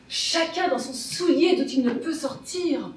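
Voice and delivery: ordinary delivery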